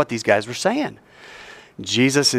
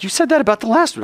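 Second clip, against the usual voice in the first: mock objection; 7 semitones higher, much faster than usual. Imagined listener objecting.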